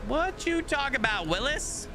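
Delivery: hammed-up sitcom catchphrase. Streamer quoting a sitcom line at the game, hammed up. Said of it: "quoting the famous catchphrase".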